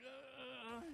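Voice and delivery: soft, strained "ugh"; bracing groan. Retold or imitated. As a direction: groan quietly as if bracing for the worst. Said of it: "So I'm bracing myself".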